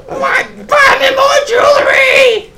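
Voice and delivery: very high, drawn-out screechy falsetto; mock woman. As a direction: squeal a demand in exaggerated falsetto. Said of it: "that's my impression of women".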